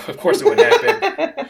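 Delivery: breaking into a high laugh. Real, not acted. Player admits the plan might fail, laughing. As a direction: laugh the words out.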